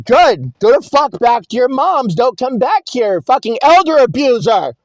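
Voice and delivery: loud, high-pitched accusing shout; angry yelling. Retold or imitated. Relative banishes teller back to mom's, angry shouting. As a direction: hurl the insult in fury. Hostile relative voiced in story.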